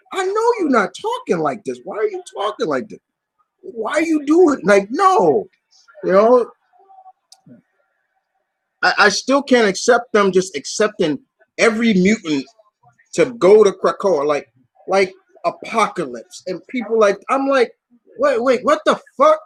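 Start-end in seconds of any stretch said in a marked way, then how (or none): 0.1-5.5 high-pitched, exasperated disbelief; incredulous scolding. Acted. Fan telling Storm off for talking like this, incredulous. 18.2-19.4 high-pitched baffled protest; incredulous outburst. Acted. Fan reacting to every mutant getting into Krakoa, incredulous. Acted reaction to villains being accepted.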